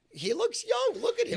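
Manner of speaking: very high mocking squeal. Teasing a co-host about his baby face, high squealing mockery. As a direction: tease him in a shrill falsetto.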